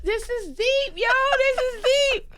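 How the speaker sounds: very high-pitched, amazed squeal; awestruck excitement. Retold or imitated. Actor reliving hearing the Motherland code name, high-pitched amazement. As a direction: squeal it as the revelation hits.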